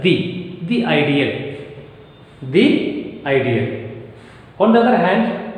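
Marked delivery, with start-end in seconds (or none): 0.0-1.6 "the ideal" as thee ideal. Shown as a demonstration. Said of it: "is to be pronounced as". 2.4-4.0 slow "the ideal", long ee in "the"; "the" as thee. Shown as a demonstration. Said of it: "is to be pronounced as".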